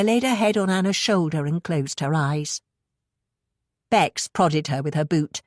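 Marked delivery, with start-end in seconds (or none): none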